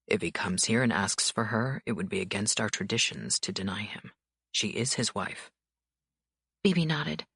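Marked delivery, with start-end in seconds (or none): 0.1-5.5 lower, calm, controlled man's voice; cool authority, unhurried; stern mafia boss. A narrator's reading. Dante voiced by narrator.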